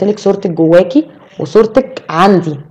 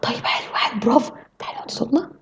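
soft, hushed praise; encouraging whisper; 5 semitones higher, 11 dB quieter than usual. Retold or imitated. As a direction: whisper approval, coaxing her to hush. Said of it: "فممكن يقول ايه؟".

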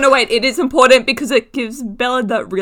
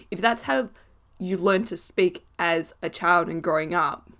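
usual speaking voice

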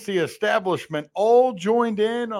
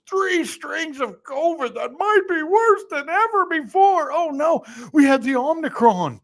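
mock panic; 9 semitones higher than usual. Mock panic over covid strains.

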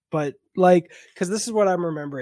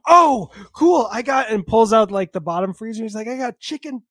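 eager host; 6 semitones higher, 7 dB louder than usual. Retold or imitated. Friend proudly offers food.

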